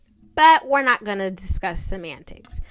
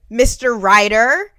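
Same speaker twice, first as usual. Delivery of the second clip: much louder call of "Mister Ryder"; mock call-out; 11 dB louder than usual. Calling out again.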